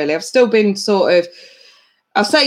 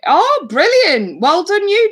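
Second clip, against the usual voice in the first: much higher and louder, slow gushing; excited praise; 11 semitones higher, 5 dB louder than usual. Real, not acted. Streamer congratulates a viewer on her degree, gushing. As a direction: gush with delight for her.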